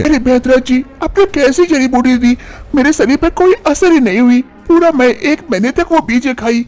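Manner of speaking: put-on high-pitched young wife's voice, complaining; young wife Manisha.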